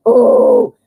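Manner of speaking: a breathy high ooh of surprise; unvoiced high ooh. Real, not acted. Reader reacting to the card with a high voiceless ooh.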